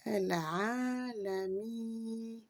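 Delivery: El Alami with the i held very long. Shown as a demonstration. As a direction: stretch the i in El Alami. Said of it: "le I long". (El Alami, le i bien allongé)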